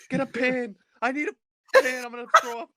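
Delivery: desperate plea to mom; hammed-up panic; panicked yelling. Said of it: "I'm like".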